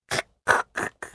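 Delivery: fast voiceless kuk-kuk laugh; breathless soundless chuckle; silent inhaled laugh. Retold or imitated. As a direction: demo a laugh with no voice. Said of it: "って音が出ない".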